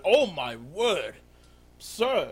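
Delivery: loud, drawn-out astonished exclamation; shocked guard. Acted. Guard captain seeing the body on the bed, shocked. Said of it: "And he looks, uh, he looks over at the bed".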